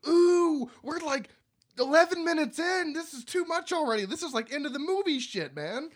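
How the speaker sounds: high, mock-stunned gasp at the gore; shocked viewer. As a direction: play the overwhelmed viewer. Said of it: "You're like".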